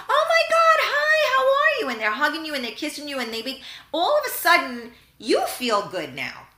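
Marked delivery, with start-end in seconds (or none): 0.1-1.9 very high, loud, squealing hello; gushing greeting. Retold or imitated. Host imitating a cheerful friend greeting her at the door, high and squealing. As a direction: gush like an overjoyed friend spotting you.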